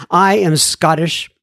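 normal talking voice

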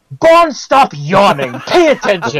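high, slow, scolding schoolmaster; crisp disapproving governess. Retold or imitated. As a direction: scold Bond like a tired teacher. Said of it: "and it's like".

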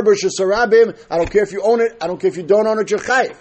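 usual speaking voice

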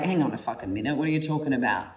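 a lightly voiced stern inner man pulling her up; masculine side. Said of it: "And he's like".

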